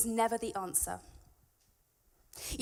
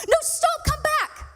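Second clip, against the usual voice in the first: full-throated yell, much higher and louder; urgent shout; 11 dB louder, slower than usual. Retold or imitated. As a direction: yell at a child running to the road. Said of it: "I shout".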